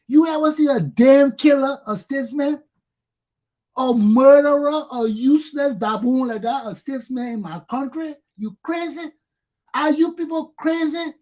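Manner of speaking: furious rant. Real, not acted. Railing at voters who call a killer a statesman, furious. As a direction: rage at the people who honor him.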